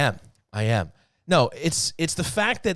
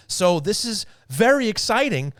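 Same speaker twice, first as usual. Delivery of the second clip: higher-pitched excited sports host; 7 semitones higher, 4 dB louder than usual. Real, not acted. Host wrapping up an argument for Islander fans, excited. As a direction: sell the thrill with real excitement. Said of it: "the excitement in your voice".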